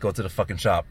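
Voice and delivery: ordinary delivery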